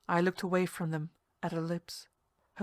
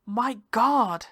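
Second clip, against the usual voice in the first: shocked exclamation; 3 semitones higher, 7 dB louder than usual. Acted. Startled outburst at the woman.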